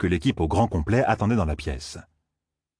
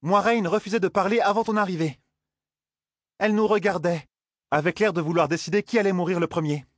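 much higher-pitched character voice, complaining; sardonic Zarine; 11 semitones higher than usual. A narrator's reading. Huntress tells the latecomer of the tense wait, high and sardonic. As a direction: play a sharp-tongued huntress in a high voice.

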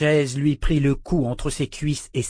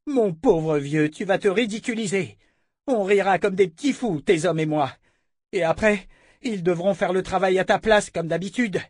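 higher, sneering taunt; mocking woman; 8 semitones higher than usual. A narrator's reading. Captive woman humiliates the brute, sneering. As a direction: belittle him with scorn. Callan mocks Nas.

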